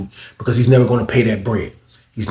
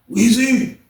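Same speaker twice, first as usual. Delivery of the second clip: high-pitched imitated name call; 13 semitones higher than usual. Imitates John John calling him.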